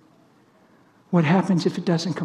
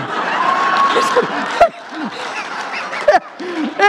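big burst of real laughter; loud high laugh; 6 dB louder than usual. Real, not acted. Laughing hard at the cashier's odd reply.

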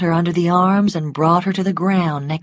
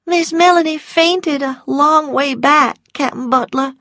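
much higher, anxious voice; frightened Prissy; 10 semitones higher than usual. A narrator's reading. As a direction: answer in fright, pitch raised throughout. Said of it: "Prissy's frightened voice answered".

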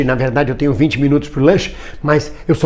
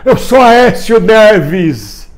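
very slow, higher rallying cry; imitated protest shout; 4 semitones higher, much slower than usual. Man recalling his next slogan to viewers, mock shouting. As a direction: stretch it out like a street chant. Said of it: "eu passei a gritar".

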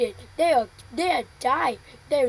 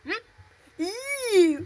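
normal talking voice

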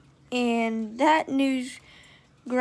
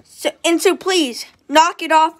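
scolding; 3 semitones higher, 8 dB louder than usual. A narrator's reading. Girl scolding her boyfriend, firm and louder. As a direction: tell him off firmly.

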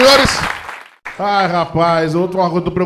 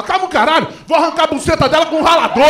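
furious daughter; 7 semitones higher than usual. Retold or imitated.

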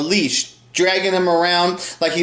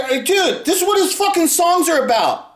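exasperated retort; 12 semitones higher than usual. Retold or imitated. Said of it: "It's like".

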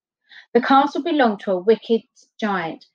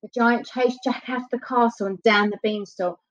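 plain reading voice

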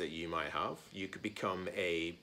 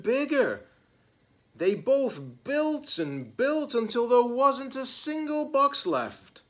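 rising, louder read-aloud towards a climax; building storybook narration; 7 semitones higher, 8 dB louder, slower than usual. A narrator's reading. Reader tells children the building grows, mounting excitement. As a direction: build the suspense, stretching the final phrases. Reads a picture book, building up.

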